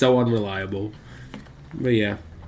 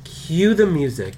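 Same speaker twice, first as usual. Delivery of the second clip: mock announcer voice; 6 semitones higher, 6 dB louder than usual. Host announcing the game show in a hammed-up announcer voice. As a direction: roll it out like a TV presenter. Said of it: "change up the accent".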